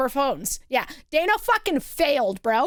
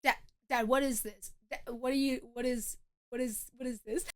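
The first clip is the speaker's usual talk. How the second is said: low, very soft mortified mumbling; hushed mock protest; 6 semitones lower, 12 dB quieter than usual. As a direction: imitate an embarrassed son pleading under the breath. Embarrassed son's hushed protest acted.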